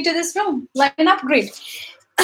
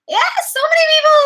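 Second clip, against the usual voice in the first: high and loud with delight; thrilled at the crowd; excited; 13 semitones higher, 5 dB louder, faster than usual. Real, not acted. Streamer thrilled as many viewers join, excited.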